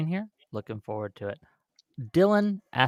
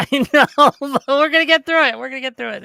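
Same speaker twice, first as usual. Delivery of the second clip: laughing; 8 semitones higher, 6 dB louder than usual. Real, not acted. Host laughs off tech trouble.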